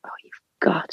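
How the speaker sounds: retold whisper of disbelief. Woman told she can't eat in labour, whispered disbelief.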